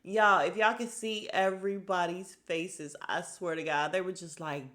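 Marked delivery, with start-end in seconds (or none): none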